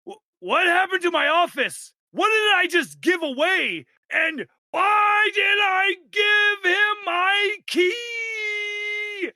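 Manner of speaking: high, loud, horrified yelling; panicked shout. Acted. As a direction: shriek it in rising panic. Boss discovers his wrecked office.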